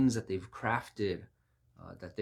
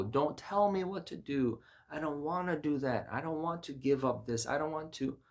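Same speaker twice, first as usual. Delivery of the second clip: mildly petulant protest, raised pitch; petulant refusal; 6 semitones higher than usual. Acted. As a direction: resist like someone told what to do. Acts the soul resisting God.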